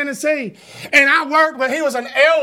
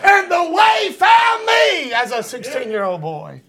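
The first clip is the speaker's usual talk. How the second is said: fiery preaching; 5 semitones higher than usual. Real, not acted. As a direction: preach it out to the congregation. Preacher testifies at full force.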